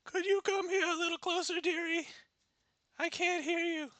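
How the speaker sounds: high, fast sly granny voice; wolf grandma. Acted. Wolf grandma luring Red closer, high sly granny voice. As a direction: coax her closer like a sly old granny.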